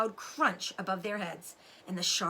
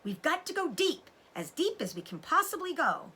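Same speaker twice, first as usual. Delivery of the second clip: commanding father; 9 semitones higher than usual. A narrator's reading. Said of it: "ordered Mister Fox".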